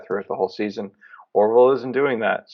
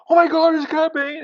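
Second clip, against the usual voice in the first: much higher, squealing "Oh my God, it's Cad Bane!"; fanboy squeal of excitement; excited squeal; 4 dB louder than usual. Retold or imitated. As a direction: squeal like a giddy fan. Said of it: "shaking in my chair".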